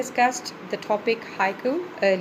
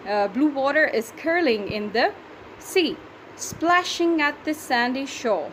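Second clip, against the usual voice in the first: ordinary delivery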